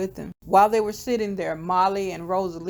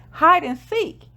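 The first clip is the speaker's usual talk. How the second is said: put-on little kid voice; 10 semitones higher, 6 dB louder than usual. Little boy answers game question.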